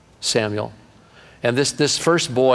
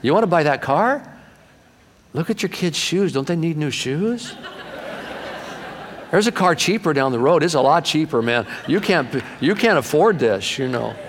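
lightly hammed-up, higher, pushy pitch; mock bad salesman; 4 semitones higher than usual. Preacher playing a hopeless car salesman, lightly hammed. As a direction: play a salesman talking buyers out of it. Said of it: "I make a horrible car salesman".

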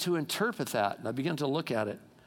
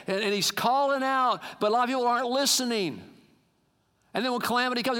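higher-pitched, insistent exhortation; urgent full-force preaching; 6 semitones higher than usual. Real, not acted. God crying out to a church that isn't listening, preached urgently. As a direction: drive each promise home like a warning cry.

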